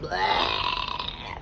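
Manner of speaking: low gag noise; mock vomit blah. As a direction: fake throwing up. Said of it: "vomited all over me".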